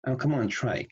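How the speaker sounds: ordinary talk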